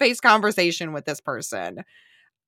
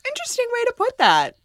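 high amused remark; faster than usual. Retold or imitated. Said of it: "I was like".

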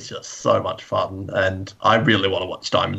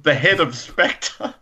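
normal talking voice

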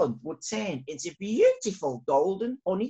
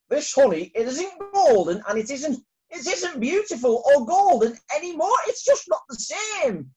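higher, louder grumbling villagers; townsfolk complaining; 8 semitones higher, 6 dB louder than usual. Acted. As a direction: complain like disappointed customers, higher. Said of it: "the townsfolk said".

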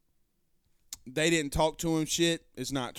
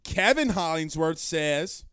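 ordinary delivery

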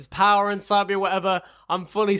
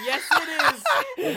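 teasing; much slower than usual. Real, not acted. Teasing her sibling.

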